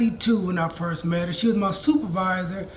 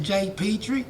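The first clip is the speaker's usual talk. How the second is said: lightly attempted Texas accent. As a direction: imitate a Texan kid, lightly. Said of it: "trying to do my Texas accent".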